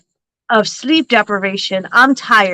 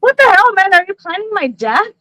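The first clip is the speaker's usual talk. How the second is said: mock outrage; 8 semitones higher than usual. Real, not acted. To her husband on FaceTime, playful mock outrage. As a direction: act scandalized, pitched high. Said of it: "mock outrage at husband".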